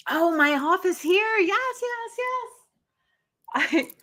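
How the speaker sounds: high bright sing-song hello; eager welcome to the room; excited greeting. Real, not acted. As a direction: greet them with a lift, playful. Greeting viewers, showing her office.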